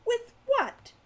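high-pitched exasperated question; irritable. A narrator's reading. Hawk answering Al mid-fight, irritably. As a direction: throw it back irritably, voice jumping up. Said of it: "Hawk said irritably".

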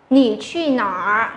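"Nỉ truy nỏ" slow with a rising start; slow teacher demo of "Nỉ truy nỏ"; slow model question. Shown as a demonstration. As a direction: ask the class "Nỉ truy nỏ" slowly. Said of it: "cô hỏi cả lớp một câu là".

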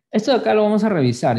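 fast, wired aside; too much caffeine. Real, not acted. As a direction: rattle it off jittery. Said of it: "que tomé mucho café".

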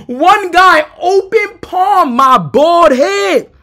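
high, slow, drawn-out disbelief; incredulous. Real, not acted. Storyteller recalling a slap on the bald head, incredulous. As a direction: stretch out each word in amused outrage.

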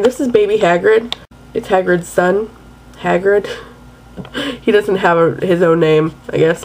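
usual speaking voice

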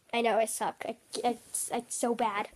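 normal talking voice

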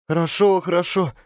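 high, meek agreement; anxious compliance. Acted. Trembling Varvara obeying Klin's orders, meek. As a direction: agree hurriedly in a timid high voice. Shaken woman obeys the boss.

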